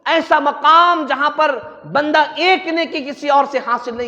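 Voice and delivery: loud, impassioned sermon; full-force preaching. Real, not acted. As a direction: hammer out the warning to the faithful.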